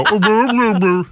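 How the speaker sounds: high puppy yowling imitation; imitated puppy noises. Host who prefers a puppy, imitating puppy noises. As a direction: make high little puppy woofs. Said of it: "when the puppies go".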